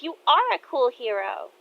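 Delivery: a hint of a young daughter insisting; daughter's voice.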